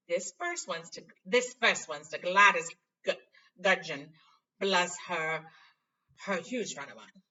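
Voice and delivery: loud, gushing Lockhart; fussy Lockhart. Acted. Vain teacher gushing over fan letters, loud. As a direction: gush over a fan's name.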